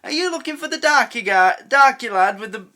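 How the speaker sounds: hint of a canteen woman calling out. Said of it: "and the woman in the canteen".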